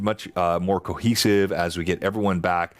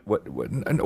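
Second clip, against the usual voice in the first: normal talking voice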